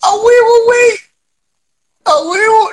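high, loud cheer; excited shout. Real, not acted. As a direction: yell it out in triumph. Cheering over a phone filter.

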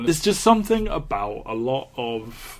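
ordinary talk, nothing marked

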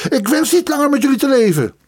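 fast, very high-pitched outburst; tearful, furious cry. A narrator's reading. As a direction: rush it out in wailing rage. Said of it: "riep de student huilend met een woedende blik". (huilend en woedend roepen)

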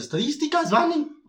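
very high squeak with a laugh; squeaky high voice. Retold or imitated. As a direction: voice cracking mid-talk, tiny high. Voice fails during presentation. (voz aguda en plena presentación)

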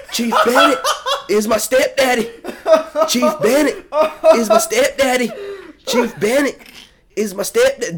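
high-pitched yell in a mock Southern accent; high, strained Southern holler, played for laughs; imitated yelling. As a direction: imitate a recruit hollering it over and over. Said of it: "just yelling".

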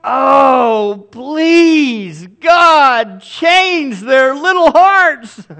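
loud, high mock-wailing plea to God; hammed-up tearful begging; desperate parental prayer. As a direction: cry out in hammed-up desperation, drawn out. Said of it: "you know what I'm thinking to myself?".

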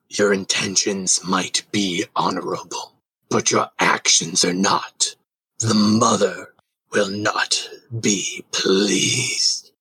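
slow, low dragon voice. Acted. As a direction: speak as an ancient dragon. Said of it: "and then responds".

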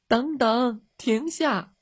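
high-pitched calling after someone. Acted. Girls running after the dog, calling out. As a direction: call out high, without much volume.

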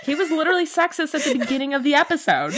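rushed, giggling through the words; laughing. Real, not acted. Cohost laughing at Sean's sexism, talking fast through giggles. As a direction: laugh it out in disbelief.

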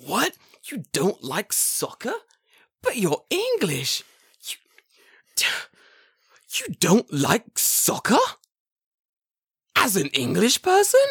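high, slow, incredulous questioning; mock outrage that an Englishman dislikes soccer; shocked disbelief. Imitating a shocked guy asking an Englishman about soccer, high and slow.

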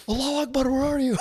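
very high, pretend holler; mock searching call. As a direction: call out in falsetto, playing a searching parent. Joking call for a lost child.

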